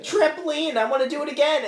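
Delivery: high, loud mock-childish glee; overexcited kid. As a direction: play a kid thrilled to bounce. Mimics friend acting childishly.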